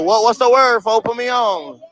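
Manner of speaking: ordinary talk, nothing marked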